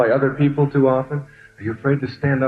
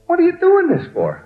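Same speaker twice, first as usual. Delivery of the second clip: high, worked-up waiter voice; the frantic waiter squealing back; agitated waiter. Retold or imitated. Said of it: "He said".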